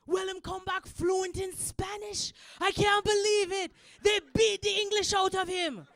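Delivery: loud, high Jamaican dad impression; Jamaican accent. Dad amazed his son came back fluent, Jamaican accent. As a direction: marvel loudly in a Jamaican accent. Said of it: "This is Jamaican accent".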